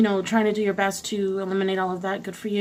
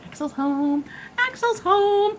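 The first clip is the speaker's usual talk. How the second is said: rising excited singsong; 12 semitones higher, slower than usual. Shown as a demonstration. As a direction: pitch climbing higher each time.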